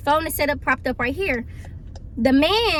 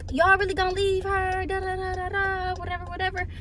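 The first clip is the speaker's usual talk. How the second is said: mock cussing out; 5 semitones higher than usual. Mother cussing out the car, mock rant. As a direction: imitate her griping in a singsong drone. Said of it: "cussing everybody out".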